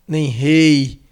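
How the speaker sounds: ordinary delivery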